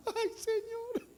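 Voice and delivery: high, slow mock wail; crying believer before God, mock wail. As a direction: sob it out theatrically. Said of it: "lloran nomás".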